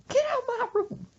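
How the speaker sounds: high-pitched screechy rant; mock scolding mama. Mother berating smart-aleck son, high mock scolding.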